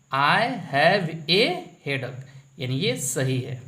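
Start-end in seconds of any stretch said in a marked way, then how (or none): 0.1-2.2 slow dictation. Shown as a demonstration. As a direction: spell it out slowly so they follow. (बहुत धीरे बोलकर समझाना)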